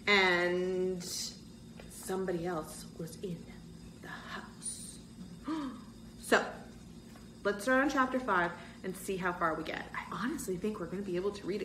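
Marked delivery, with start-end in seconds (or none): 5.2-5.8 soft gasp. Acted. Narrator reacts to cliffhanger.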